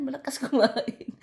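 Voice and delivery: high, loud laugh mid-sentence. Real, not acted. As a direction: laugh out the line about eating. Laughing about her appetite. (tumatawang nagkukuwento)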